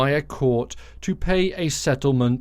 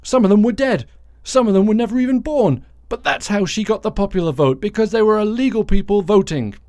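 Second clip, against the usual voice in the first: higher, louder, insistent rant; ranting Trump, in his mind; 7 semitones higher, 4 dB louder than usual. Retold or imitated. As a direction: voice a sore loser's loud, high-pitched excuse-making. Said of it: "So in his mind, he says".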